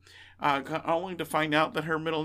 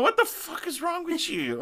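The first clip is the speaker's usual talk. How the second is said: put-on shocked kid; 13 semitones higher than usual. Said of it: "I'm sitting there going".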